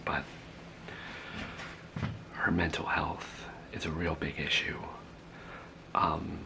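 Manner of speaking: soft conspiratorial whisper; secretive whisper. Acted. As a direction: lean in and whisper the gossip. Grandmother secretly snitching to caseworker.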